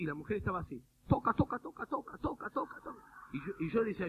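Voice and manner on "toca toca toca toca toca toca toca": rapid repeated plea, pitched up; imitated praying pastor. Preacher mimics pastor praying over a woman, high urgent repetition. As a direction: mimic frantic repetitive prayer, higher.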